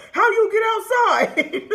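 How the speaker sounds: laughing as she mimics the startled dad; laughing dad quote. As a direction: ham up the stunned dad while cracking up. Dad shocked she walked in.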